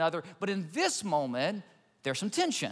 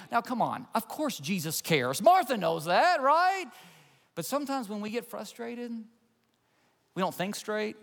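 ordinary talk

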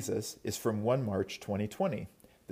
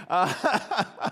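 laughing; 8 semitones higher, 6 dB louder than usual. Real, not acted.

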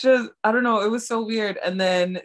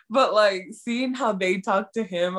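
usual speaking voice

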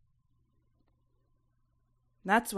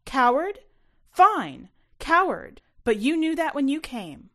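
much higher and louder, hurling it back; furious shout; 8 semitones higher, 12 dB louder than usual. A narrator's reading. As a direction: shout back at him in fury. Mom shouts back in fight.

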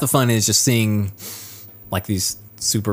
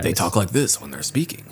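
English anime dub; 2 semitones lower than usual. Retold or imitated. Showing the co-host how dubs sound, anime dub voice. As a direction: imitate a dubbed anime character. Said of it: "what the English, uh, dub, voice dubs sound like".

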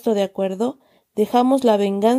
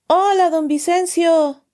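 calling out; 7 semitones higher, slower than usual. A narrator's reading. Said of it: "gritó mi tío desde la puerta".

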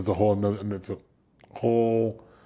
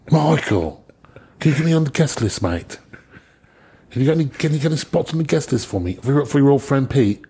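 higher, louder, fast mock English caller; pleading for the guest list in a bright imitation; 5 semitones higher, 5 dB louder than usual. Roadie imitating a British bassist begging on the phone, higher and louder. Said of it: "it's [name] from U F O".

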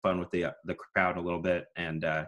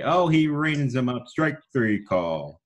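higher, slower announcer call, drawn out; strikeout call; 6 semitones higher, 5 dB louder, slower than usual. Shown as a demonstration. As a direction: ring him up with relish. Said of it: "I'd say".

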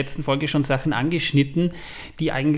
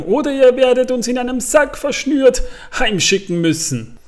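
much higher cheeky hobbit; 10 semitones higher, 4 dB louder than usual. Retold or imitated. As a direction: chirp it like a defiant young hobbit. Hobbits' line, voiced in retelling.

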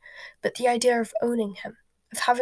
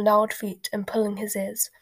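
ordinary delivery

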